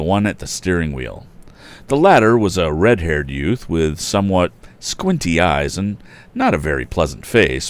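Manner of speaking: loud, animated storybook reading; story narration. A narrator's reading. Narrator reading to listeners, animated and loud. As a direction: tell the tale with gusto. Reads story with character voices.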